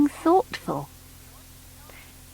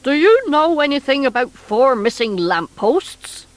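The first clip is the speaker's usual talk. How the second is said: demanding; 3 semitones higher than usual. Acted. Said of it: "he demanded".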